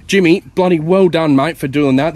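usual speaking voice

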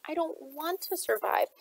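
pleading. A narrator's reading. Gemma begging Shade not to leave, pleading. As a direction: hold back the tears as it climbs. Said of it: "Her voice caught".

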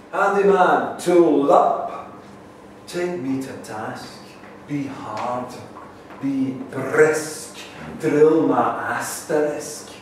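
declaimed verse, stretched last line; poem performance. Acted. Performs own poem aloud.

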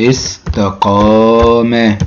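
استقام stretched very slowly; teacher dictating استقام slowly; drawn out slowly. Shown as a demonstration. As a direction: hold the long vowel of استقام. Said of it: "هيبقى عندي إيه؟".

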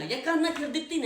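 angry shout. Acted. Husband repeats, louder.

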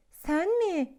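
can't believe it; little dinosaur doubting the lion cub's boast; astonished. A narrator's reading. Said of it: "demiş küçük dinozor şaşkınlıkla".

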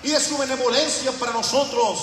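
loud, high, rising sermon delivery; full-force preaching. Acted. Pastor exhorting his congregation, loud high preaching.

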